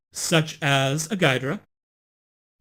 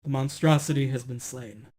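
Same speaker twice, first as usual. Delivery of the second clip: dramatic narration; 2 semitones lower than usual. Acted. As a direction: narrate the monster's fall. Narrates the monster's defeat.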